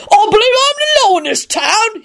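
very high, blustering bellow; high-pitched pompous bawl; bellowing constable. A narrator's reading. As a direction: bluster as an outraged officer, voice way up. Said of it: "he bellowed".

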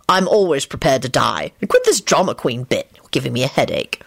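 low, unimpressed irritation; irritable. A narrator's reading. Lupin is sick of the drama queen, irritable. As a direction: brush the threat off, low and annoyed. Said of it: "Lupin replied irritably".

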